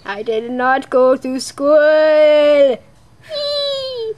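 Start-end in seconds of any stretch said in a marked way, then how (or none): 3.3-4.1 gleeful whee. Acted. Ghost flies away.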